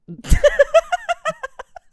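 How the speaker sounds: loud, high, rapid cackle; bursting laughter. Real, not acted. Laughing at the co-host's diaper joke, bursting cackle. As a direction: crack up suddenly.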